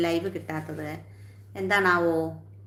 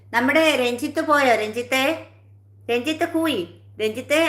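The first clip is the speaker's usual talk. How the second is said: higher, raised calling voice; 6 semitones higher, 4 dB louder than usual. Real, not acted. Streamer hailing a viewer who may have gone, calling out. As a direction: hail someone who may have left, pitched up.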